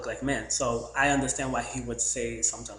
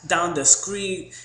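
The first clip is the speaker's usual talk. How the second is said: mock Southern drawl; 5 semitones higher, 7 dB louder, much slower than usual. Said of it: "He was saying, like".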